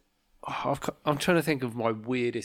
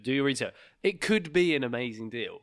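ordinary talk, nothing marked

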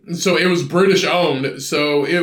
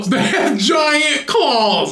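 ridiculing a friend's claim about koalas; laughing mocking repeat; 11 semitones higher, much slower than usual. Real, not acted. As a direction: scoff at the claim through a laugh.

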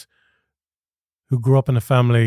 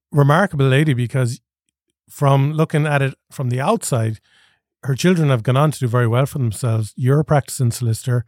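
ordinary delivery

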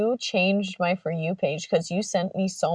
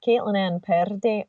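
Scottish accent. Shown as a demonstration. Showing her way into Scottish accent. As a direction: draw the name out in a Scottish accent. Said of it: "You know, Scottish, Scottish is".

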